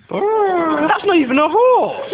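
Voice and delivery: very high, slow mocking squeak; squeaky high and slow. Mocking the horse impression.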